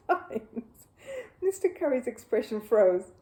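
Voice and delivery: ordinary delivery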